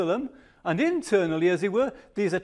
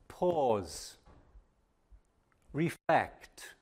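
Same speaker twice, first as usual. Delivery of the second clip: quiet reflective pause; 5 semitones lower, 8 dB quieter, much slower than usual. Real, not acted. Preacher invites quiet reflection.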